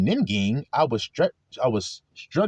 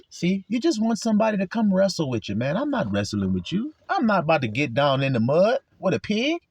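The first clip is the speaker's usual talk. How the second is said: ordinary talk, nothing marked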